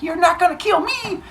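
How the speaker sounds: put-on high, defiant kid voice; defiant girl. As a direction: dare fate to try. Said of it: "Stabby is like".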